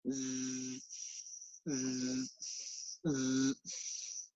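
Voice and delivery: "Zzz" buzzed, sliding into a voiceless hiss; alternating Z and S. Shown as a demonstration. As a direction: hold each "Zzz" softly, switching the vocal-cord buzz off into S. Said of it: "alternate between the Z sound and the S sound".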